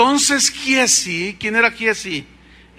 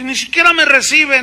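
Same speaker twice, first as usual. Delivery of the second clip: angry Naaman; 7 semitones higher, 8 dB louder than usual. Retold or imitated. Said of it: "Y se enojó".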